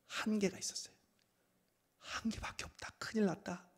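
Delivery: fast, excited chatter among friends; disciples murmuring. Retold or imitated. Disciples whispering to each other, anxious fast chatter. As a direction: gossip anxiously among yourselves. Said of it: "서로 수군거립니다".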